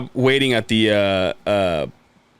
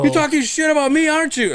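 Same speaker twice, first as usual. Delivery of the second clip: high, put-on accusing voice; Howard. Friend imitating Howard demanding an answer, high indignant voice. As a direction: press the mock accusation in a raised pitch. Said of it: "that's how Howard talks".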